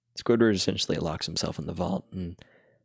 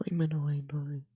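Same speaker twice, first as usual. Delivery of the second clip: gibberish whispered higher, barely voiced; whispered Doodle Bob; 7 semitones higher, 5 dB quieter than usual. Retrying Doodle Bob's gibberish for her friend, whispered. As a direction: breathe the nonsense words out like a secret.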